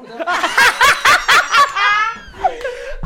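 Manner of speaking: loud, high burst of laughter; long helpless howl of laughing; shrieking laugh. Real, not acted. Actor caught out by own audition tape, shriek-laughing.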